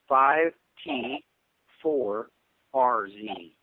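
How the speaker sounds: slow and loud, each character set apart; spelled out slowly. Real, not acted. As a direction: say it slowly and loudly, pausing between the parts. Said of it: "have to say that slowly".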